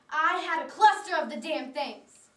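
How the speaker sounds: acted exasperation. Patient revealing she had many tumors, loudly exasperated. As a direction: burst out in frustration at the diagnosis.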